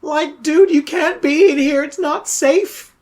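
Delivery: high, urgent dude voice; worried young dude. Acted. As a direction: warn like an agitated young slacker. Plays the young crossbow man.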